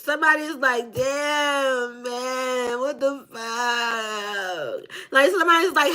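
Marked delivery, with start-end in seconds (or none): 0.9-4.8 long, drawn-out mock whine; dismayed moan. Reader voicing somebody regretting the relationship, moaning dismay. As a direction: drag the words out in weary disbelief.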